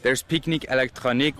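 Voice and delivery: ordinary talk, nothing marked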